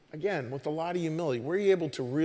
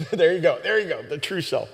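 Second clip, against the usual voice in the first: laughing; 2 semitones higher, 5 dB louder than usual. Real, not acted. Laughs at guest's phrasing.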